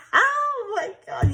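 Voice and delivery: slow, high, still laughing; shaking off the giggles. Real, not acted. Still laughing after accent.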